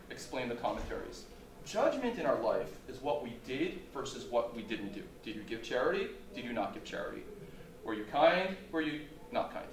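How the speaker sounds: ordinary delivery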